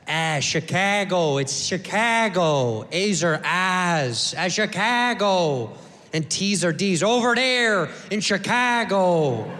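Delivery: put-on slow, drawn-out Chicago accent. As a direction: stretch the vowels like a Chicago local. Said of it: "with that thick Chicago accent".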